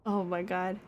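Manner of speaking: ordinary talk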